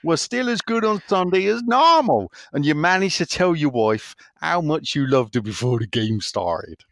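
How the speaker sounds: high, teasing West Country question; exaggerated Somerset accent, swooping up; Doddsy's Somerset accent. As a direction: quiz him in broad Somerset. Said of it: "I will do his accent".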